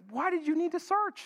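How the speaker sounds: high sarcastic retort. Retold or imitated. Jesus answering his parents, a light sarcastic question. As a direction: toss it back with teen sarcasm. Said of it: "a little sarcastically".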